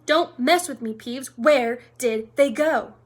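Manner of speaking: loud, impatient interrogation; angry demand. A narrator's reading. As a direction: demand an answer, losing patience. Filch losing patience with Peeves.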